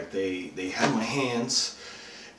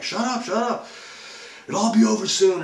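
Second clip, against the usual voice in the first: high, urgent shout to silence someone; imitated kidnappers' yelling; 13 semitones higher, 7 dB louder than usual. Kidnappers silencing their captive, imitated high yelling.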